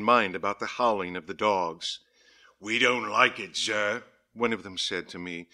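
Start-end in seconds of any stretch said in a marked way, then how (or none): 2.6-4.0 lightly voiced rustic workman; a hint of a worried villager; workman's voice. A narrator's reading. Workman complaining to the diarist about howling dogs, lightly rustic voice. Workman quoted, dialect and altered voice.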